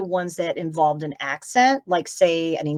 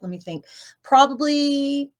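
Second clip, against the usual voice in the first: usual speaking voice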